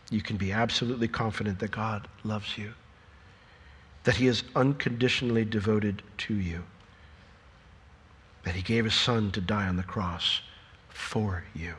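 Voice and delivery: soft, low and sincere; tender reassurance. Real, not acted. Preacher assuring the congregation God loves them, soft and tender. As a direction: drop right down and assure them gently. Reassures listeners of God's love.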